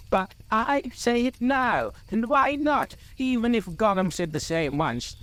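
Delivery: high-pitched defiance, settling toward the end; defiant Bilbo. A narrator's reading. Bilbo insists on keeping the ring, defiant. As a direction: dig in stubbornly, voice pitched high.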